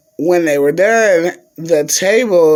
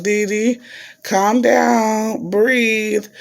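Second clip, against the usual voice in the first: higher, soothing imitation of a nurse; nurse calming a patient; 6 semitones higher, much slower than usual. Nurse telling crying Dee Dee to breathe, high soothing imitation. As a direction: coax gently in a raised pitch.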